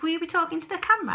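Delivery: voicing boyfriend. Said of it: "He says".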